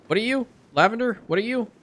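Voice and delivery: ordinary talk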